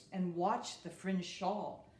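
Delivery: ordinary delivery, nothing marked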